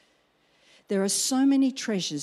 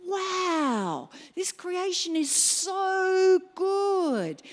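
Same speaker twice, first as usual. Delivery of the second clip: slow awe; 10 semitones higher, much slower than usual. Retold or imitated. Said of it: "I take pictures and I go".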